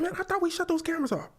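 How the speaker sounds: high, rushed officer voice; fast, high-pitched dismay; mock dismayed officer. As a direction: imitate a flustered officer caught on camera. Acts officer dismayed at being filmed.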